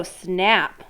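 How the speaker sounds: plain reading voice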